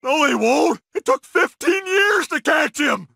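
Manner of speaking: high-pitched anguished protest; despairing outburst. Acted. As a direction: wail against the reassurance. Despairs at being reassured.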